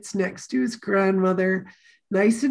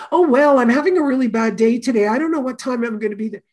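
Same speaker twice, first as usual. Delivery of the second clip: fast, higher mock excuse; acted rush of explanations, louder at the start; rambling excuse; 3 semitones higher, 5 dB louder, faster than usual. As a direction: rattle off a put-on flustered excuse. Acts out a rambling shop owner.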